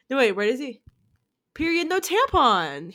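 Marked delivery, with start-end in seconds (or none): 1.5-2.9 quoting catchphrase. Retold or imitated. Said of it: "Who used to say that?".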